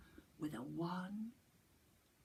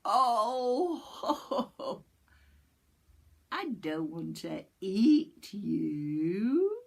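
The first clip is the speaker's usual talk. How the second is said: louder, slow, chuckling reassurance; hammed-up sly fox; sly laughing fox; 3 semitones higher, 8 dB louder, much slower than usual.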